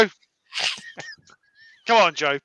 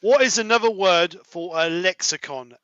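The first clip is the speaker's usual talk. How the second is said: normal talking voice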